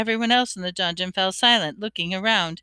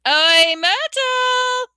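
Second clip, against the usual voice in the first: high, loud drawn-out call; bellowing; 6 dB louder, much slower than usual. A narrator's reading. Poltergeist summoning a ghost girl, bellowing. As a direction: bellow the name across the room. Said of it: "and bellowed".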